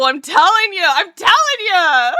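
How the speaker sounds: high, loud, drawn-out protest; mock-emphatic loud cry; playful insistence. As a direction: holler it insistently, stretching the repeat. Playful insistence after a joke.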